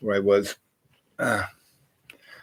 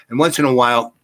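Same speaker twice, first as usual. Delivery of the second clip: ordinary talk, nothing marked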